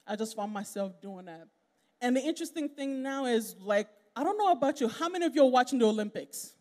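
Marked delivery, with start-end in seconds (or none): none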